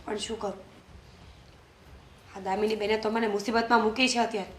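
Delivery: soft, low apology to herself; quiet remorse. Real, not acted. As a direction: murmur your regret alone. Alone, regretting the quarrel. (ધીમા અવાજે પસ્તાવો)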